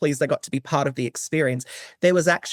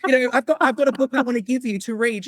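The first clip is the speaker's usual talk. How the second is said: excited rush; 9 semitones higher than usual. Retold or imitated. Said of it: "I actually ran over and said".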